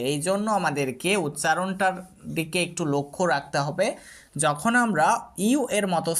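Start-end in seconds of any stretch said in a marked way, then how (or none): none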